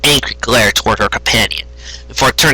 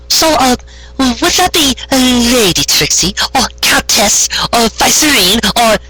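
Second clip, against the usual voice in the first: much higher, slow, fawning pony voice; eager Carrot Top; 11 semitones higher, slower than usual. Acted. Carrot Top bowing to Trixie, eager high voice. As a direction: ask with wide-eyed eagerness.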